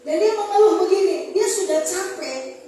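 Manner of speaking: loud, insistent proclamation; impassioned preacher's complaint on someone's behalf; forceful preaching. Acted. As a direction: press each question home to the congregation. Preaches God's words forcefully.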